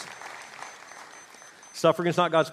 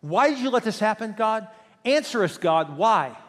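higher, louder pleading demand; demanding complaint; 5 semitones higher, 5 dB louder than usual. Acted. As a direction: press God hard for an answer. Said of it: "it was more like this".

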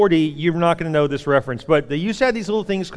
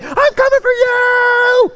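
very high, slow, stretched-out yell; drawn-out scream; 7 dB louder, much slower than usual. Retold or imitated. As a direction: stretch it into a long mock-menacing scream. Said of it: "And I was like".

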